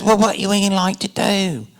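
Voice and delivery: put-on high-pitched Australian accent; lilting, high mock-Australian voice; Baz. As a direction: imitate an Australian director questioning an actor. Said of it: "I'll try to do the accent".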